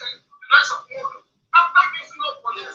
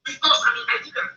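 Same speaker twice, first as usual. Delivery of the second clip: usual speaking voice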